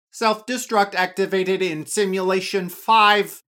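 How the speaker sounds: loud, level computer announcement; a machine alarm voice; robotic voice. A narrator's reading. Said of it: "a robotic voice says".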